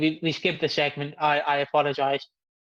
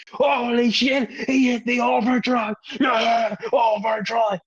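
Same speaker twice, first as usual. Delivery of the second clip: excited yelling; 6 semitones higher, slower than usual. Retold or imitated. Shouting from the bathroom at the wrestling move. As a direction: yell it like the big move just landed. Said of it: "all you could hear from me was".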